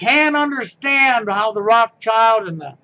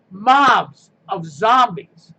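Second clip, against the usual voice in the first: slow, louder shout of mobs of zombies; emphatic shout; 2 semitones lower, 4 dB louder, much slower than usual. Real, not acted. Ranting to viewers about protesters, drawn-out shout. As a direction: hammer the phrase mobs of zombies home.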